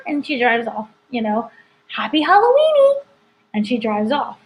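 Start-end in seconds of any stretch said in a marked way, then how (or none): 1.9-3.0 very high, singsong farewell; cheery neighbor impression. Host retelling Agnes's farewell as she drives off, mock-cheery neighbor. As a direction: call it out like a chirpy neighbor, voice way up. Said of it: "And she's like".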